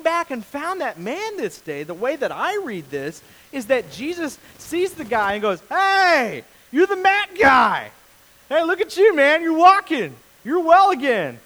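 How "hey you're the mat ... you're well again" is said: loud, high, delighted hail; big hollered greeting, words stretched; excited greeting. Acted. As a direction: call out to a long-lost buddy. Jesus greeting healed man, acted.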